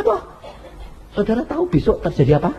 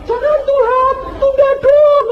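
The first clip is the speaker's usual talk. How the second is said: mock crying plea; 4 dB louder than usual. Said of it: "Terus nangis".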